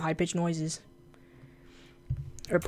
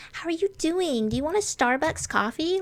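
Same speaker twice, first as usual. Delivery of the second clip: high, sing-song imitation of a bubbly girl; mock a chirpy girl's high voice; squeaky mock girl asking about coffee; mocking girly voice; 9 semitones higher than usual. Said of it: "I don't like high-pitched noises and voices".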